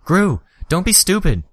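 high, loud desperate shout. A narrator's reading. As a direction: yell it at a reckless friend. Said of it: "I shouted".

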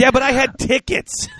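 high-pitched, wailing complaint; pleading squeal, hammed-up; mock whiny protest. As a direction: protest like a kid denied a treat. Mock protest over cancelled trip.

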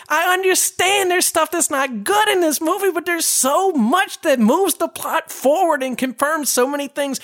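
high, worked-up ranting, calming down; incredulous and pleading; exasperated rant. Real, not acted.

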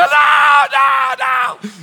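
very high, loud wailing no; crying kid refusing. Retold or imitated. Father voicing his son refusing the ride, high wailing. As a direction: wail like a panicked child.

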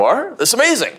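high, surprised "that's amazing"; imitating an impressed friend; retold amazement. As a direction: squeak it out in mock delight. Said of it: "And Joel's like".

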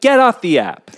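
loud, high exasperated outburst; exasperated shout. Retold or imitated. Host retells reaction to dating app excuses, exasperated shout. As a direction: holler it in mock outrage.

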